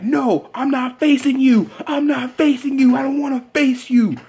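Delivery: high-pitched mock protest from a wrestler; panicked refusal, imitated. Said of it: "And Triple H is like".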